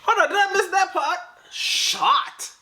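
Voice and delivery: very high-pitched, squeaky alarm; shocked disbelief. Acted. Listener reacting to a missed shooting, hammed-up disbelief. Exaggerated shock at hearing "shot.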